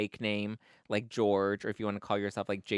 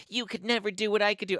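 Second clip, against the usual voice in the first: higher-pitched mock boasting; mock taunt; 9 semitones higher, 4 dB louder than usual. Imitating her bragging at me, mock taunt. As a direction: put on a taunting rival.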